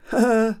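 short, loud scheming laugh; gloating laugh. Acted. Father hoping for a broken shop window, gloating laugh. Herr Clown gloats over mishap.

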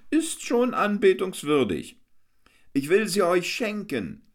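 high, boisterous clown hamming; Hans Wurst the clown. A narrator's reading. Hans Wurst boasts to the crowd, high and boisterous. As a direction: work the crowd like a fairground clown.

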